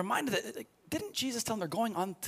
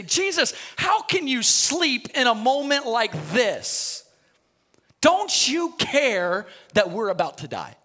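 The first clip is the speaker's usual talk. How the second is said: panicked disciples; 4 semitones higher, 7 dB louder, slower than usual. Retold or imitated. Disciples wake Jesus in storm.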